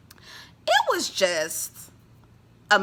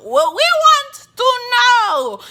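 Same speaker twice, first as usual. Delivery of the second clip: high, loud insistent questioning; playful loud demand at Kiki; mock demand; 10 dB louder than usual. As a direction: loudly demand the secret, higher.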